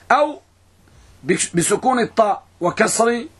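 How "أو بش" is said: ordinary talk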